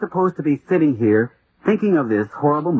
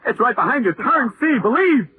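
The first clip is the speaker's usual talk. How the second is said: high frantic urging; 11 semitones higher than usual. Acted. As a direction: plead with rising panic. Pointing out monster to mother.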